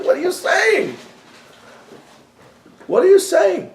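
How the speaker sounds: high outraged disbelief; indignant disbelief. Real, not acted. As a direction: rebbe to audience, rising high in shocked disbelief. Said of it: "outraged retort".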